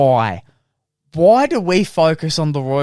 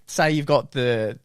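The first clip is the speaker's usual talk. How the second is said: usual speaking voice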